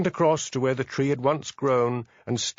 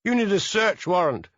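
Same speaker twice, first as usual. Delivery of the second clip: higher, sharp mock shout at the constable; a narrated shout, only lightly raised; 9 semitones higher, 3 dB louder than usual. A narrator's reading. Son objecting to the police search, mild shout. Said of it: "shouted Harry".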